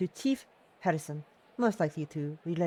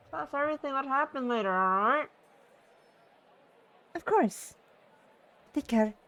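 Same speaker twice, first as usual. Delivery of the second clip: bright farewell pitched higher; higher cheerful character voice; 7 semitones higher than usual. Acted. Inka says goodbye to the group, higher cheerful voice. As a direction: wave goodbye to everyone in a higher voice.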